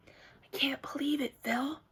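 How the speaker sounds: secret unvoiced whisper. Kid whispering to her friend Philip, secretive. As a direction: whisper it so nobody hears. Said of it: "whispered to each other real secret".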